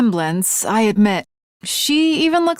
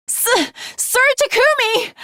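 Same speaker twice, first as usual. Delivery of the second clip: normal talking voice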